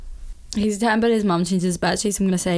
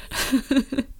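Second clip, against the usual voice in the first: amused laugh; 6 semitones higher, 3 dB quieter than usual. Real, not acted. Laughs at her own impression.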